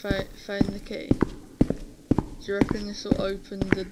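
usual speaking voice

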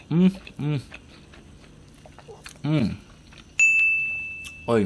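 humming while chewing food; closed lips, chewing; mouth full, chewing. Real, not acted. Seen 0: Bite at -1.0; lips closed, jaw moving while humming.

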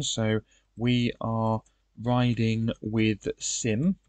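drawn out slowly while typing it in; slow dictation. Shown as a demonstration. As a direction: spell it out slowly as if writing it down. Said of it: "so I'll write that in the information".

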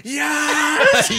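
high, loud mock cheer; imitated gleeful yes. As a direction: shout it as a hammed-up celebration. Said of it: "you went like this, you went".

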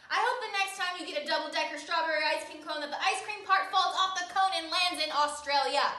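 high and loud, spiteful; spiteful rant. Acted. Boy shouting a spiteful wish at Paul. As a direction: pile the curse on gleefully. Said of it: "I said to Paul".

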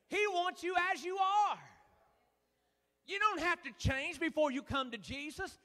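ordinary talk, nothing marked

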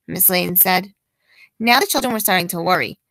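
ordinary delivery, nothing marked

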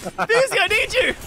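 high, loud plea to a teammate; urgent call for help. Real, not acted. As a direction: shout for backup mid-fight. Calls teammate for help in fight.

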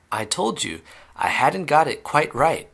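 plain reading voice

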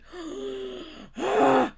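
big, loud breathy gasp; sharp overwhelmed intake of breath; shocked gasp. Real, not acted. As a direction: gasp in disbelief. Overwhelmed by the song.

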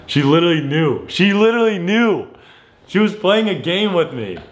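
worked up after being caught out; incredulous outburst. Real, not acted. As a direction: exclaim it in amazed disbelief. Prankster exclaims at being found out.

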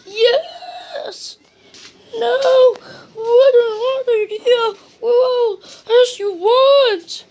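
very high, put-on storybook voice; high-pitched, theatrical character reply; exclaiming character voice. A narrator's reading. Mister Clumsy and rescuer exchange cries.

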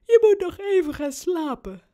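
talking through a big yawn, high and loud. A narrator's reading. Sleepy dad sending boy back to sleep, yawning. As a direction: let a yawn stretch the words. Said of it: "zegt papa en hij gaapt".

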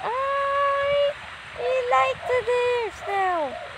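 high, slow, dreamy gushing. Acted. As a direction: melt over the cute deer. Still gushing over deer.

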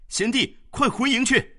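very high, loud call; urgent shout. A narrator's reading. General shouting to his comrade amid battle, urgent. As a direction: shout across the melee.

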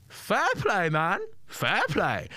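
high mimicry of the comedian, drawn out; James Acaster impression. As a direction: copy the comedian's voice. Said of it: "That's a really weirdly good impression".

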